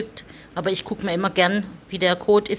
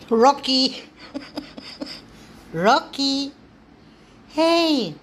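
calling the cat; 7 semitones higher, slower than usual. Real, not acted. Owner calling the cat Rocky in a high coaxing voice. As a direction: lift the voice to coax the cat.

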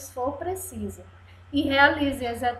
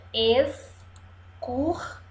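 "excursão" slowly split into es, cur, são; long pauses between syllables of "excursão"; 3 dB quieter, slower than usual. Shown as a demonstration. Teacher shows three syllables, "excursão" broken up. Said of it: "Três sílabas".

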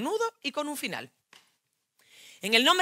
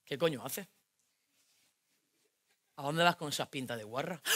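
put-on low, softer macho boyfriend; 7 semitones lower, 10 dB quieter than usual. Girlfriend voicing her jealous boyfriend, low and softer.

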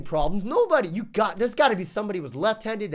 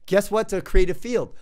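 ordinary talk

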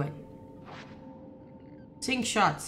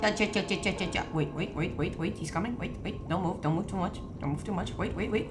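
tense coaching; 2 semitones lower, 5 dB quieter than usual. Real, not acted. Player timing babe's shot as he's coming, tense coaching. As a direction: hold the partner back with hushed urgency. Coaching partner as enemy nears.

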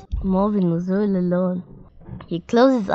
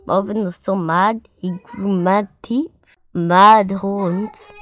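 ordinary delivery

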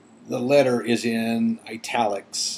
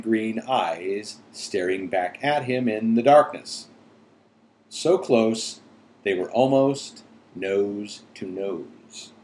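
ordinary delivery, nothing marked

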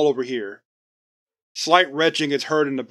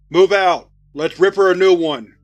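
loud, higher battle command; shouting orders over battle; 3 semitones higher, 6 dB louder than usual. Acted. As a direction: bark the order over the noise. Ordering the team forward.

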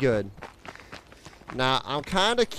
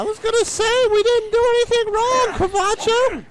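squealed protest in a very high voice; loud, comically high squeal; high-pitched protest; 5 dB louder than usual. Pretend. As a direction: protest your innocence in a loud falsetto. Mock protest to companion after close call.

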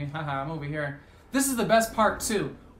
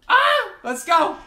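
very high, loud victory cry; real hype shout; triumphant yell; 12 semitones higher, 10 dB louder, slower than usual. Real, not acted. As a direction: shout it as the win lands. Clutch win.

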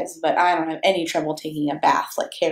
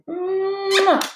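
blown kiss; 12 semitones higher than usual. Real, not acted. Blowing kiss to viewers.

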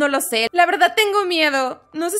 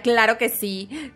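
normal talking voice

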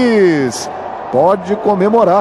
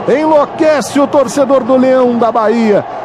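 ordinary talk, nothing marked

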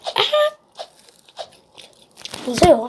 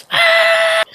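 high startled yelp; 14 semitones higher, 6 dB louder than usual. Real, not acted. Sudden in-game shock.

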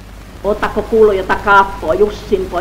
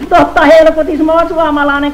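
1 shouted reply; 6 semitones higher, 6 dB louder than usual. Acted. Shouting answer from the dark.

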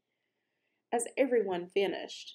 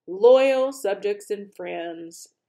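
loud, slow king's proclamation; booming formal speech; royal address; 11 dB louder, slower than usual. A narrator's reading. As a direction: address your subjects grandly, slowly. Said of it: "his voice echoing in the almost empty room".

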